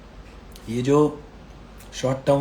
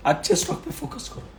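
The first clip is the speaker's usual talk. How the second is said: अच्छे स्टॉक पे फोकस करो pushed up high but softer; higher, thinner voice on पे as the sore throat strains; strained high repeat; 9 semitones higher, 5 dB quieter than usual. Real, not acted. To viewers, a strained high softer repeat. Repeats advice several times.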